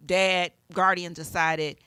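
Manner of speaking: ordinary delivery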